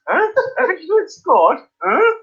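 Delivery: high-pitched baffled questioning; imitated puzzlement. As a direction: mimic confused neighbours late to the goal. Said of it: "You could hear this".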